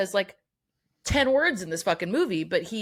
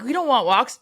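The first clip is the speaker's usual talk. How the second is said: mocking scouts; 7 semitones higher, 7 dB louder than usual. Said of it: "They're like".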